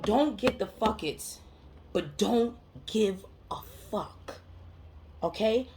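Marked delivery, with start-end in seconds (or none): 0.0-4.4 slow, word by word; measured, spaced-out delivery; deliberate emphasis. Real, not acted. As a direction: spell out the motto with pauses. States main point deliberately.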